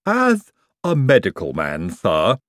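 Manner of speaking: lisping medical henchman; earnest lisping servant voice; Igor. Acted. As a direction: lisp it with professional dignity. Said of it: "he said".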